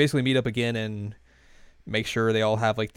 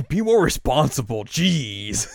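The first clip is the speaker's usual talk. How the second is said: louder, exasperated mock telling-off; retold scolding; 3 semitones higher, 5 dB louder, slower than usual. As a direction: scold like a fed-up brother. Brother scolds her, acted.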